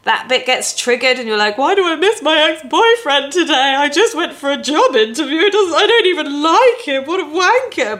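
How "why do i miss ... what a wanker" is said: high, louder, hammed-up exasperation; exasperated. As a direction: whine it at yourself in a high, worked-up pitch. Voicing someone triggered about an ex.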